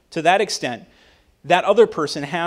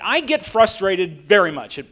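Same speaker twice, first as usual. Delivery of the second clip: ordinary talk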